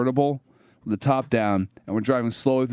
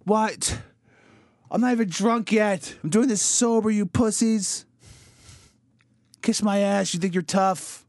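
crown yourself king, hammed up; pizza king boast; 10 semitones higher, slower than usual. Pizza king silencing the crowd, high hammed-up boast.

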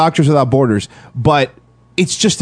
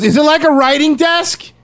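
high-pitched mock-eager question; eager interjection; 11 semitones higher than usual. Friend cutting in on the bag boy's riddle, mock-eager. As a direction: imitate a friend jumping in with a guess.

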